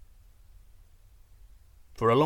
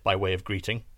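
plain reading voice

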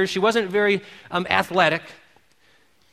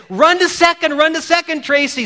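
higher, louder call to keep running; cheering shout; 8 semitones higher, 3 dB louder than usual. Retold or imitated. Crowd calling Tracy to second base, cheering shout. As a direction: urge her on to second. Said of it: "So they say".

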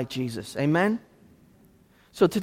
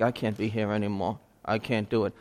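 weary despair; 7 semitones lower, 7 dB quieter than usual. Retold or imitated. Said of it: "he's like".

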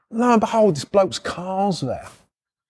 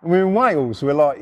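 ordinary talk

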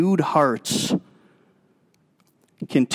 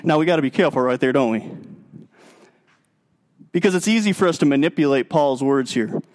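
ordinary talk

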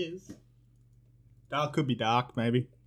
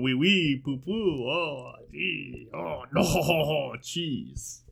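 mock French; much slower than usual. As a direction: fake French babble. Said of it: "I'd spend the whole time just going".

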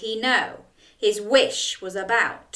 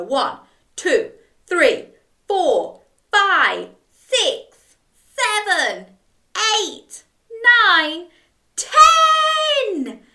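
rising, louder count to a high cheering ten; excited counting; 10 semitones higher, 6 dB louder, slower than usual. A narrator's reading. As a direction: count up with growing glee.

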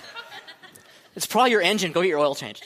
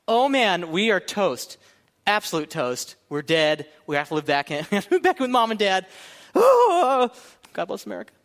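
hammed-up despair; 4 semitones higher, 4 dB louder than usual. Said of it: "it was like".

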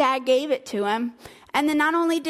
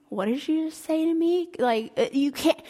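higher pitched, incredulous question; stunned disbelief; 5 semitones higher than usual. Acted. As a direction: ask it as if you misheard. Son stunned by father's words.

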